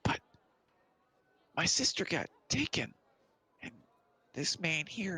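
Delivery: put-on high, halting child voice; a kid making an earnest plea; little kid. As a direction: pitch up like a small child explaining himself. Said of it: "And the little kid goes".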